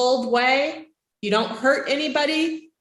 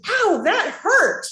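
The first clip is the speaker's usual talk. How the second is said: honest hurt reaction; 8 semitones higher than usual. Shown as a demonstration. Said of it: "you can have an honest reaction".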